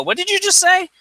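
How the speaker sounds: daring him to repeat it; indignant challenge. Retold or imitated. Zero confronting the foul-mouthed customer, indignant and high.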